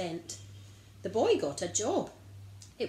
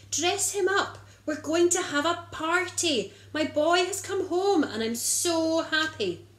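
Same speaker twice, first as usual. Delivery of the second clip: overjoyed father; 8 semitones higher, 6 dB louder than usual. Acted. Father celebrates son's return.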